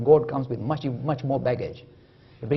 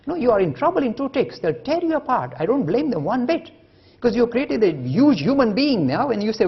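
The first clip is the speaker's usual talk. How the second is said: higher, louder heated warning; louder indignant lecture; loud outraged rant; 8 semitones higher, 7 dB louder than usual. Acted. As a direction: rail against it at full voice. Scientists tearing you apart.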